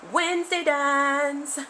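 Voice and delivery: slow, loud show announcement; slow loud mock announcement; announcer voice. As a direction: present it like a show act. Announcing her weekly dance.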